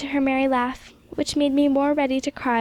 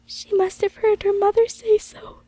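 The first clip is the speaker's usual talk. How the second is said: mild choked-up, higher voice; understated choking on the words; 8 semitones higher than usual. A narrator's reading. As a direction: hold back tears as you speak. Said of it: "said Missus Miller in a choking voice".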